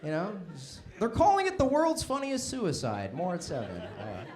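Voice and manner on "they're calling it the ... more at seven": news anchor. Pretend. Mock news anchor sign-off.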